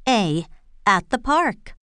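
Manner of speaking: ordinary talk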